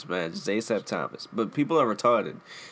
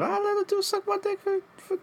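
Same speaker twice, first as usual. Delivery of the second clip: high mock voice; 3 dB quieter than usual. Mock voice asking the question.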